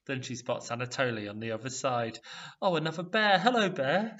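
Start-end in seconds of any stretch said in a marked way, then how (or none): 2.6-4.2 high, loud nervous greeting. A narrator's reading. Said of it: "she says nervously".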